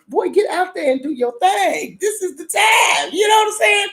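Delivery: high, hyped-up shouted urging; excited urging. Real, not acted. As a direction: cheer him on from the couch. Urging character to fight.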